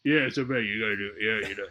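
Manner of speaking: low, soft, mumbling Stallone voice, lightly done; mock Stallone mumble. Imitating Stallone off set, low and soft. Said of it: "but outside".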